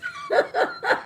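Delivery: high squealing laugh; playful shriek of laughter. Real, not acted. Spooked by the card, laughing.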